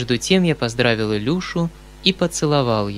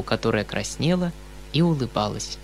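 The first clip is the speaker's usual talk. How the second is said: ordinary delivery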